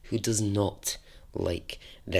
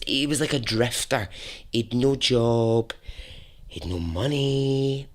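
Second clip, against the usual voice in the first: ordinary delivery, nothing marked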